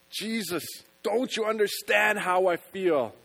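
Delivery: loud, high, slow anguished cry; screamed out. Pretend. Believer screaming at Jesus in pain, loud anguished cry. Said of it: "inside screaming out".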